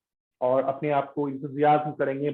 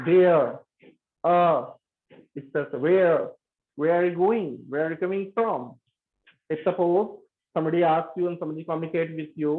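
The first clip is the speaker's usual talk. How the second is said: ordinary delivery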